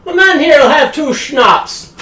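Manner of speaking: high, loud, drawn-out tavern shout; calling out an order. Acted. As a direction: holler the drink order across the room. Guide character calls out drink order.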